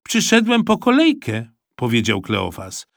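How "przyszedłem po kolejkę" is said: put-on much higher, louder kid voice; child matter-of-fact to his mum; innocent boy. A narrator's reading. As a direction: say it as if it's obvious. Boy answering mother.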